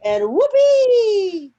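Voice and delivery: whoopee, slow and high with a loud whoop; gleeful whoopee. A narrator's reading. Reading to kids, the spinning turns thrilling: whoopee. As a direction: let it fly like a ride taking off. Excited ride on record player.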